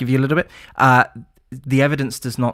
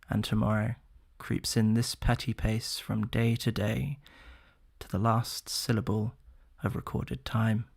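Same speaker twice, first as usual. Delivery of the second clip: Shakespeare recitation; 5 dB quieter than usual. Shown as a demonstration. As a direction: let each line fall away into quiet, weighty and grave. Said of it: "give us a little Shakespearean".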